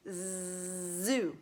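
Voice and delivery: "Zoo" with a buzzing drawn-out z; z sound demo. Shown as a demonstration. Teacher modelling the z sound in "Zoo" for pupils. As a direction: buzz the z in "Zoo".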